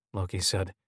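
plain reading voice

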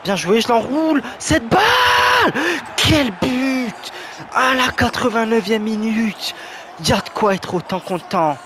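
loud, high goal celebration; excited sports commentary. Real, not acted. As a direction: shout it like a last-minute winner. Last-minute winning goal celebrated.

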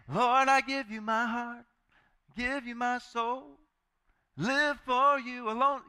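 slow, high, breathy-free pleading prayer; pious prayer. Retold or imitated. People praying to God.

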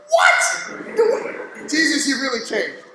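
breathy high gasped what, then laughing through the rest; mock shock with a laugh; shocked disbelief. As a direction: play it as stunned and amused. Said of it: "I would've been like".

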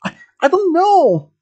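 very high stammered protest; panicked. A narrator's reading. Student answers the gunman's demand, panicked.

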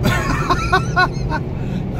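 high breathy burst of laughter; giddy laugh. Real, not acted. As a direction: laugh in thrilled disbelief. Laughs after second kick.

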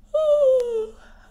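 loud, high drawn-out yawn sound; hammed-up yawn for the children; big yawn sigh. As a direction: open wide and yawn it out.

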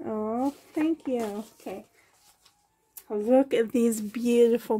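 ordinary talk

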